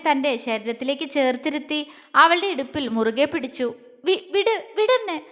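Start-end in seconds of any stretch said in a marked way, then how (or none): 4.1-5.2 flustered, higher-pitched stammer; struggling free. Acted. Girl squirming out of his embrace, flustered stammering. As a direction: stammer it out while wriggling loose. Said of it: "കുതറിക്കൊണ്ട് പറഞ്ഞു".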